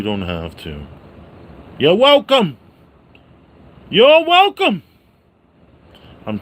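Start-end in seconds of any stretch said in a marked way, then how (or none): 1.8-2.6 loud catchphrase. Acted. Catchphrase repeated. 3.9-4.8 You're welcome squeaked way up high; high squealed you're welcome. Acted. Vlogger sign-off catchphrase in high falsetto.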